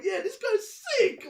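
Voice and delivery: high, hyped-up exclamation; excited rave. Retold or imitated. Reviewer raving about a grime MC, high excited cry. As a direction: burst out with delight, pitch leaping up. Retells enthusiastic reaction to a rapper.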